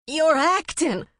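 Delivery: high, loud, slow accusing shout. A narrator's reading. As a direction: yell the accusation at them. Waking up accusing the couple.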